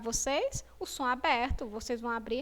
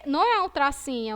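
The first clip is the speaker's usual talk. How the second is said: usual speaking voice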